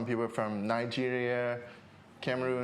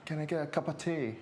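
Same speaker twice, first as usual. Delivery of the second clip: slightly higher, playful British accent attempt; tentative British impression on request; 3 semitones higher than usual. Shown as a demonstration. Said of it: "can you do some of the accents".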